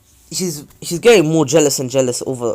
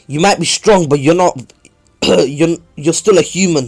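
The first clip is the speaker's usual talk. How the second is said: higher, louder pleading with a friend; urgent raised plea to a rival; 4 semitones higher, 7 dB louder than usual. Retold or imitated. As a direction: push back hard, voice up. Naruto arguing with Sasuke.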